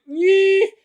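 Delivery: squeaky high mock reply; high goofy voice of the players; silly high voice. Players' cheeky reaction voiced.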